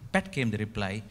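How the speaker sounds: ordinary talk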